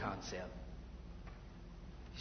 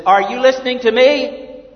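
loud and higher, fed-up nag; mock exasperated wife; 9 semitones higher, 19 dB louder than usual. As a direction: play the wife who finally snaps. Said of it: "your wife gets closer and says".

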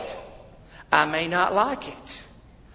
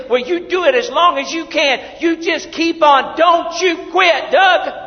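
preaching climax; 8 semitones higher, 6 dB louder than usual. Acted. Preacher voicing God urging Doug loudly. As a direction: thunder it out.

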